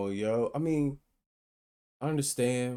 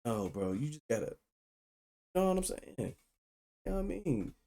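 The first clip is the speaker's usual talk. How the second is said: usual speaking voice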